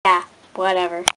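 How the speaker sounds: ordinary talk, nothing marked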